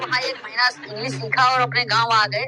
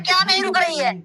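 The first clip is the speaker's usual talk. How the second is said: mock exasperated doctor; 7 semitones higher than usual. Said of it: "अरे बोला".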